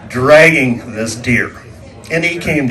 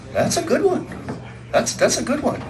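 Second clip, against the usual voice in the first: much higher, softer voice; soft high-pitched grudging approval; conceding the point; 11 semitones higher, 8 dB quieter than usual. Retold or imitated. Feigned approval in retold argument.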